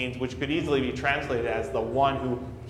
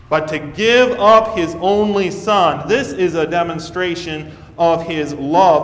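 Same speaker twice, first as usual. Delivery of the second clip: preaching at full force; 5 semitones higher, 7 dB louder than usual. Acted. Preaching climax.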